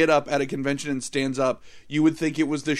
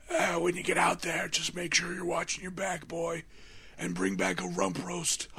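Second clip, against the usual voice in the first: quieter, lightly put-on scratchy growl; mock scratchy Tony Todd; 3 semitones higher, 6 dB quieter than usual. Host mocking Tony Todd's weak line reading, scratchy and soft. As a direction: imitate a worn-out actor's shot voice. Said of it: "his voice is completely shot".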